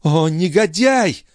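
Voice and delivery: high, drawn-out outcry; anguished exhalation. A narrator's reading. Sister collapsing after reliving the attack, high drawn-out anguish. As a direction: breathe it out slowly on a high pitch. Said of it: "наконец выдохнула она".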